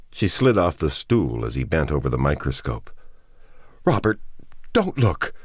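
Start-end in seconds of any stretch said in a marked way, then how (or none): 3.8-5.3 high, desperate plea; sudden pleading. A narrator's reading. Ruth begs Robert not to look, high and pleading. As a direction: beg him not to look. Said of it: "she begged suddenly, her voice pleading".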